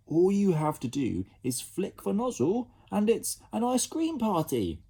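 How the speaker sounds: high, bright encouragement; selling the fun to kids; cheerful coaxing. Acted.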